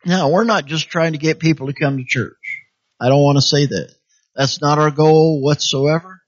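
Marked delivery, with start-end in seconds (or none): none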